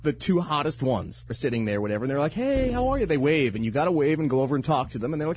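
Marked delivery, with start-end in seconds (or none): 2.3-3.1 flirty mom greeting. Pretend. Acting flirty moms at coffee.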